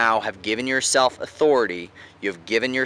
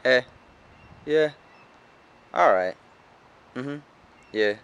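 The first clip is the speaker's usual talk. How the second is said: robot-like, nothing behind it; short, indifferent replies with no oomph; neutral tonality. Shown as a demonstration. Coach demonstrating neutral tone, emotionless like a robot. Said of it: "neutral, for example".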